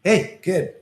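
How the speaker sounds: put-on high, loud call of an older kid. Teen calls to a younger boy leaving school, loud and high. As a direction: sing out "Hey, kid" like a teen spotting a mark. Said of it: "and they're like".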